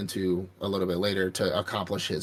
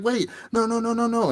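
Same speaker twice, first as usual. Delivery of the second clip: high panicked "no no no"; high, frantic mock pleading; 13 semitones higher than usual. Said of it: "He's like".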